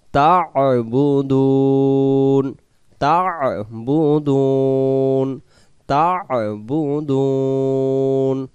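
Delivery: slow melodic tajweed chant; Quran recitation. Shown as a demonstration. Teacher reciting the verse for students, slow chant. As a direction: recite slowly, stretching each vowel.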